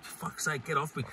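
fed-up mutter, barely voiced; muttered exasperation. Fed up with drunken hugs.

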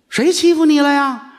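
high, loud indignant question; indignant nun. Acted. Nun loudly demanding who bullied him, high and indignant.

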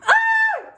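very high, very loud shriek; startled scream. Real, not acted. Vlogger shrieking as her sushi falls apart. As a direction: let out a sharp scream of alarm.

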